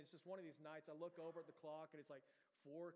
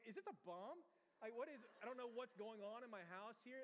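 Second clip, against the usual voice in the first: higher-pitched comic alarm; mock freaking out; 5 semitones higher than usual. Homeowner panicking over the beeping box, mock freaked out. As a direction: play up bewildered panic. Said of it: "freaking out".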